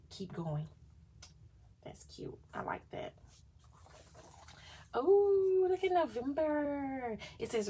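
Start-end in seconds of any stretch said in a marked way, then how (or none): 1.8-3.1 whispering. Real, not acted. Whispered admiration over planner page. 5.0-7.2 high, slow, delighted; excited gasp. Real, not acted. Delighted discovery of November page.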